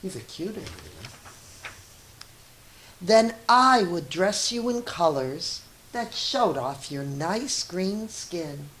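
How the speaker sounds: ordinary delivery, nothing marked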